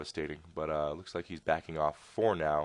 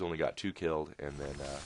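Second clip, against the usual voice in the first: ordinary talk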